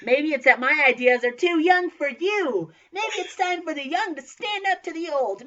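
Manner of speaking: high, loud rousing speech; rally the class, voice soaring; impassioned speech. Acted. Acted impassioned revolutionary speech.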